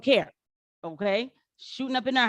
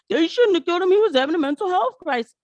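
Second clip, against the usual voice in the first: higher, louder mock protest; retold family plea; 11 semitones higher, 5 dB louder, faster than usual. Family defending him after the standoff, mock protest. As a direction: imitate relatives protesting in a high, raised voice. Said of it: "And then the family said".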